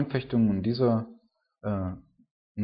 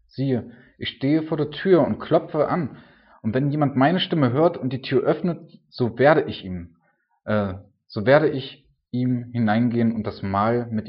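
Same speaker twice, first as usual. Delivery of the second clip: preaching at full force; 2 semitones higher, 5 dB louder, slower than usual. Acted. Preacher proclaiming Christ knocking at the door, loud and slow. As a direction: boom it out like a revival preacher.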